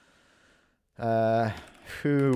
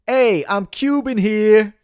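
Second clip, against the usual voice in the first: put-on New York accent; 9 semitones higher, 9 dB louder, slower than usual. American [name] answering the host, New York accent. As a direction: ham up a Long Island tough guy. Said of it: "it's your ridiculous accent".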